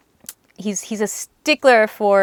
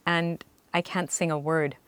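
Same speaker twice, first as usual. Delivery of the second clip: usual speaking voice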